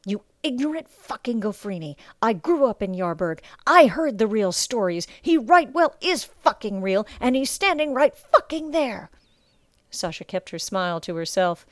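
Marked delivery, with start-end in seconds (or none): 0.1-9.1 much louder and higher, building to a fierce yell; furious sailor. Acted. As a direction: rage at your mate's ignorance. Sailor insists Rusk is real.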